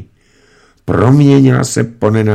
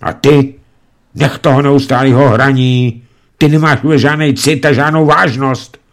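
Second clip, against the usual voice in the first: raised, scolding voice at a son; snapping scold; 6 semitones higher, 4 dB louder than usual. A narrator's reading. Mother scolding her son for playing records, raised angry voice. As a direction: cry out at him sharply. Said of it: "rozkřikla se pojednou".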